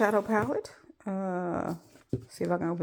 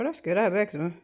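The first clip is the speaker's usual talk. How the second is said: ordinary talk, nothing marked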